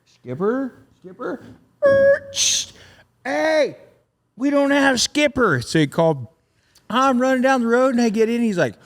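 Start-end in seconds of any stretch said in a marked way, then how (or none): 0.1-5.6 high, searching call of a name; mock calling out. The driver searching for his missing Skipper, calling high. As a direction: call out as if looking for someone in the dark.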